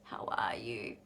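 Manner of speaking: whispered, careful "How are you?" before bad news; hushed concerned greeting. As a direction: softly sound out their mood. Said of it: "when someone's about to tell you bad news".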